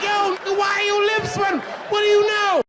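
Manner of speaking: terrified passenger yelling at the attendant; panicked. Retold or imitated. Nervous flyer to sweating attendant, high panicked yell.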